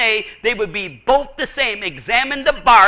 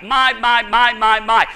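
My punched louder in a repeated run; stress on My; 5 dB louder than usual. Shown as a demonstration. Preacher singling out the psalmist's word, emphatic repeated "my". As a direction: hammer out each "my" evenly and firmly. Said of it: "a person uses the word my".

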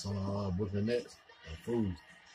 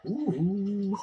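high, loud ooh; excited whoop; 12 semitones higher, 7 dB louder than usual. Real, not acted. Fan reacting to Patty's basket, excited high whoop.

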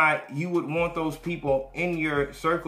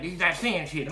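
ordinary talk, nothing marked

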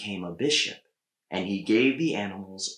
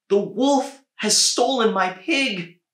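high, loud wail of a poor woman; a desperate peasant woman pleading; distraught woman; 12 semitones higher, 7 dB louder than usual. A narrator's reading. Poor woman weeping over her stolen pig, high desperate wail.

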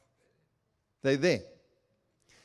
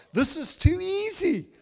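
much higher and louder; high, loud disbelief; incredulous; 13 semitones higher, 7 dB louder than usual. Real, not acted. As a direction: cry out in disbelief. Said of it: "his own doubt at tarry night".

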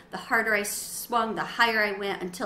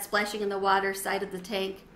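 usual speaking voice

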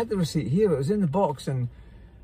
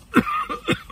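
choking cough. Real, not acted. Choking on camera.

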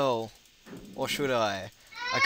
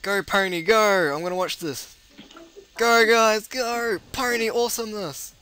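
loud, higher-pitched cheer; excited cheering; 6 semitones higher, 5 dB louder than usual. Real, not acted. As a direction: cheer the pony on loudly. Cheering on the pony in game.

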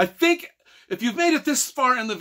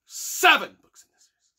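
higher and louder, stressing seven; emphatic insistence; 6 semitones higher, 6 dB louder than usual. Real, not acted. Vlogger correcting trilogy commenters, emphatic insistence. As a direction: pound the number home.

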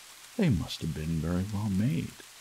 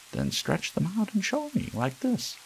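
much higher taunting dare; challenging wild rabbit; 13 semitones higher than usual. A narrator's reading. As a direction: taunt him to show it. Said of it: "said the wild rabbit".

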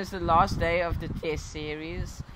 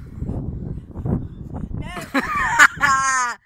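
laughing; 7 semitones higher than usual. Real, not acted. Laughing at friend.

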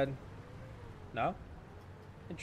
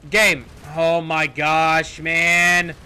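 slow, drawn-out loud groan of frustration; loud exasperation; 7 dB louder, much slower than usual. Real, not acted. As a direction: stretch each word in loud exasperation. Vehicle fails on the hill.

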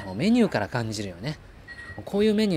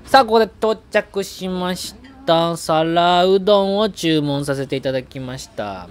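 loud, higher, slow emphatic announcement; punchy variety show voiceover; 6 semitones higher, 7 dB louder, slower than usual. Acted. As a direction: announce the dish like a TV host.

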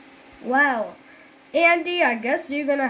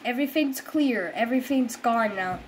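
ordinary talk

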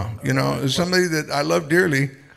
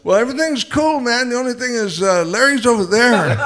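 imitating the stranger; 6 semitones higher, 5 dB louder than usual. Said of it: "All of a sudden he goes".

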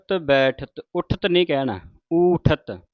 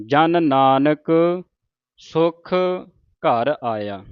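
slow and word by word, louder on ਜਨ ਨਾਨਕ; ਹੌਲੀ ਹੌਲੀ ਉਚਾਰਨ; slow recitation; 3 dB louder, much slower than usual. Shown as a demonstration. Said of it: "ਇਸ ਤਰ੍ਹਾਂ ਉਚਾਰਨ ਕਰਨਾ ਹੈ".

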